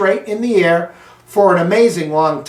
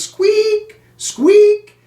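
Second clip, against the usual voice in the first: hamster squeak; 14 semitones higher, 4 dB louder than usual. Acted.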